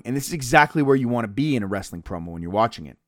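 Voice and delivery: ordinary talk, nothing marked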